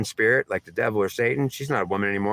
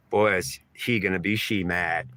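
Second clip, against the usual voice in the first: ordinary talk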